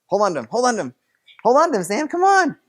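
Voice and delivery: rising to high, loud cheering-on; excited urging. Real, not acted. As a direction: coach him through the tussle, voice climbing.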